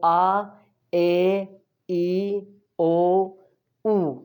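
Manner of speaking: आ, ए, ई, ओ, ऊ held one by one; Spanish vowel drill. Shown as a demonstration. Teacher recaps five vowels, separated आ, ए, ई, ओ, ऊ. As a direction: sound out "aah, ay, ee, oh, oo". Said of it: "ये थी pronunciation पांच vowels की".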